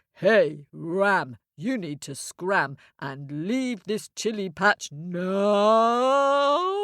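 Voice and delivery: slow cow speech swelling into a long rising "now"; mooing cow. Acted. Cow orders the ram off the patch, mooing. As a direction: moo the words, stretching the last one out.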